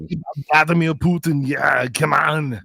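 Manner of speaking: a playful hint of Austrian Arnold for a Russian; Arnold Schwarzenegger accent. Joking with cohosts about Putin, in a light Austrian Arnold accent. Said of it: "you're doing Arnold for a Russian accent".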